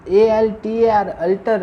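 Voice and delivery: usual speaking voice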